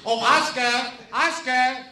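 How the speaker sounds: high, cheerful call across the room. Acted. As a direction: call him over sweetly. Calling Oscar across the hall.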